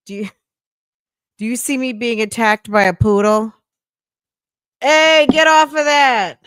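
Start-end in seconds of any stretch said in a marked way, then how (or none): 4.8-6.4 sharp loud yell at the dog; real shout. Real, not acted. Shouting at a poodle pawing her, loud. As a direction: bark it out to stop the poodle.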